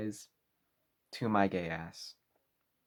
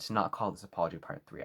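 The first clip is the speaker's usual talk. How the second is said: ordinary delivery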